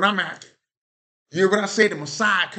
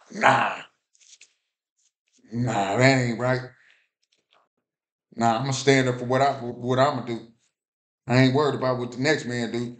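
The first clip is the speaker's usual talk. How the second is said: lightly voiced hardheaded sinner, lower pitch; defiant sinner; 6 semitones lower than usual. Preacher imitating a stubborn sinner who refuses to change, low and dismissive.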